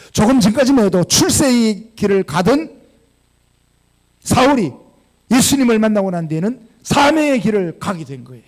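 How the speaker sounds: full-force pulpit preaching; fervent preaching. Acted. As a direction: build to the climax with force.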